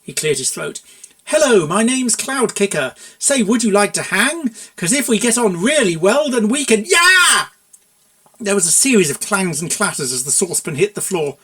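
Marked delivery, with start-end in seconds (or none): 1.2-7.5 higher, louder, sing-song advert pitch; cheesy ad jingle. Acted. Buck pitching a rhyming advert for the mayor, hammed up. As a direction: sell it like a cringey jingle, ending on a high whoop.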